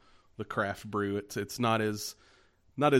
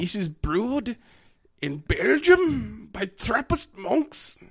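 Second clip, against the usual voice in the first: hammed-up German accent, much higher and slower; a bad German accent, pitched up and dragged out; mock German accent; 12 semitones higher, 6 dB louder, much slower than usual. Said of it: "do that in your best German accent".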